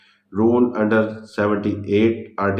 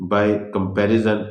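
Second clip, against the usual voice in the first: very slow, word by word, as if dictating notes; slow heading read; much slower than usual. Shown as a demonstration. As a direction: spell out the heading slowly so students can write it. Reading out a heading slowly. (धीरे धीरे शीर्षक लिखवाना)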